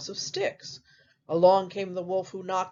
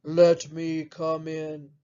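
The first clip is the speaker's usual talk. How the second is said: story wolf; 4 semitones lower than usual. Acted. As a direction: call through the door as a low-voiced wolf. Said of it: "knocks on the door and says".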